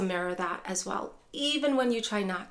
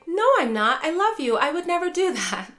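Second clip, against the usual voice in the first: higher and louder, protesting; defensive denial; 7 semitones higher, 5 dB louder than usual. Retold or imitated. Caregiver protesting to accusing mom, high and loud. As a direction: rush to deny the accusation.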